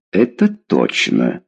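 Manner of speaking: low, slow, ominous agreement; stern father. A narrator's reading. Father agreeing his daughter is done for, stern. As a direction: confirm it slowly and grimly. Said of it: "согласился с дочерью Фёдор".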